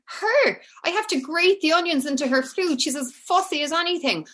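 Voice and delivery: high-pitched, exasperated retort; incredulous mother. Retold or imitated. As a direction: imitate a baffled mum protesting about her fussy child. Mother's baffled retort acted out.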